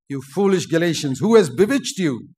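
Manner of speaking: forceful preacher reading out a reproach; strong rebuke. Acted. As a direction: challenge them sternly from the pulpit. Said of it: "we need strong preaching".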